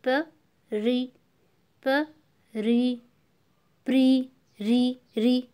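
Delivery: "प्री" split into "प" and a long "री"; उच्चारण demonstration. Shown as a demonstration. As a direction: break "प्री" apart, holding the ई in "री". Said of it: "र पे किसकी आवाज़ आ रही है". ("प्री" को तोड़कर, "री" लंबा बोलते हुए)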